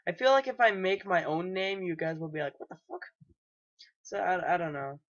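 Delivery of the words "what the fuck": tiny, high, hushed voice; mock disbelief. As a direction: squeak it very softly, imitating baffled viewers. Imitates the viewers' puzzled reaction.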